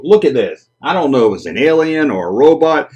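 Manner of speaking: ordinary delivery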